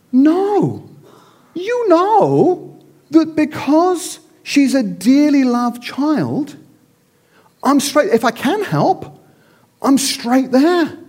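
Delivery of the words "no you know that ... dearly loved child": normal talking voice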